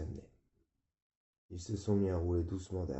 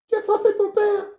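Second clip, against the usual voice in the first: shrill, very high screaming mother; 13 dB louder than usual. Acted. Mother screaming at her son for hitting his father. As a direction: scream it in horror, near tears. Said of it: "Elle gueulait".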